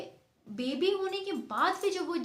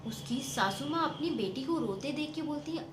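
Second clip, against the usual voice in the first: usual speaking voice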